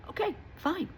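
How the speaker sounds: high, dismissive "okay fine"; sing-song grudging acceptance; mock grudging okay. Mocking a reluctant listener.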